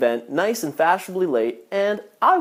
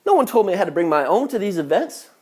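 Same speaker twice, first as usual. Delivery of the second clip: usual speaking voice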